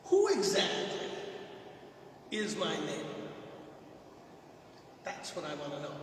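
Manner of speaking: lawyer's sly question. Retold or imitated. Preacher voicing the lawyer testing Jesus, mock-lawyerly. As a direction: play the clever lawyer setting a trap. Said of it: "a thing a lawyer would do".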